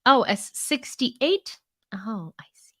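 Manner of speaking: ordinary talk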